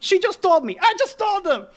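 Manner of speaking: high-pitched exasperated outburst; exasperated yelling. Acted. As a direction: yell it back, voice shooting way up. Acted exasperated shout back in quarrel.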